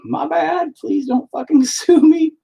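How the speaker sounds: high, sheepish mock apology with a laugh; imitating the copier. The caught artist begging not to be sued, in a high mock voice. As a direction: squeak out a guilty apology. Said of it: "he immediately was like".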